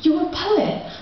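high, gushing mock parent voice. Mock gushing parent cooing at the poet. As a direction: coo at the poet like an overexcited mum. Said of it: "they're like".

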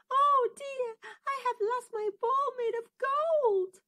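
a princess about to burst into tears; upset princess. Acted. Princess loses her golden ball in the pond, wailing high and loud. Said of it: "She began to cry".

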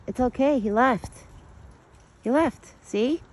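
high, sweet voice calming a scared deer; soothing pet talk. Acted. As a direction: coo to the frightened deer. Coaxing the deer.

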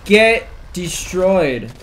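loud gloating yell; triumphant shout. Real, not acted. Killing an enemy player.

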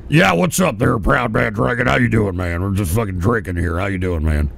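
deep mock drunk caller. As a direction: play the buddy drinking on the line. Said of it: "you sound drunk".

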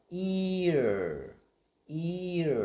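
"ear" high, loud and stretched, gliding from ee into r; blended vowel demo. Shown as a demonstration. Teacher joining e and er, slow loud glide. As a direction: draw "ear" out slowly so both parts are heard. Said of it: "và ghép với er mình có".

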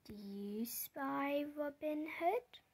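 ordinary delivery, nothing marked

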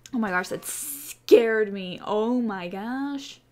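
ordinary talk

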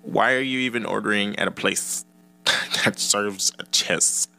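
a hint of a scoffing critic; mock incredulous viewer. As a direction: play a viewer who can't believe anyone skips cheese. Imagined viewer objects to cheeseless pizza.

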